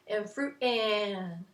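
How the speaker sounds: slow, with a long final 'and'; short 'fruit', stretched 'and'; drawn-out rhythm. Shown as a demonstration. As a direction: hold the last 'and'. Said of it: "that went on for a while".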